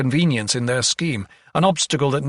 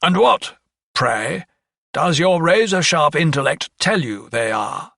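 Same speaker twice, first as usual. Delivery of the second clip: high, loud opening, pointed questioning; sarcastic challenge; 5 semitones higher, 4 dB louder than usual. A narrator's reading. Lawyer challenges the doctor's intellect, sarcastic. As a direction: needle them with mock politeness, pitch raised.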